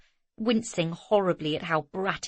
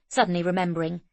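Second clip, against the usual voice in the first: usual speaking voice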